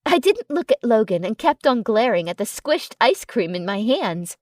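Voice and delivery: plain reading voice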